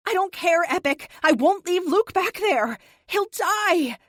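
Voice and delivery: distraught loud refusal to Epic; desperate shout. A narrator's reading.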